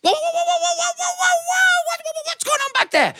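very high yelping cries; a goat bitten from behind, panicking; shrill startled whoa-whoa. Acted.

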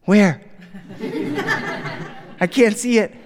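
put-on high, insistent little-kid voice; puzzled small child complaining; three-year-old girl. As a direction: pipe up like a bewildered toddler. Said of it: "my three-year-old daughter".

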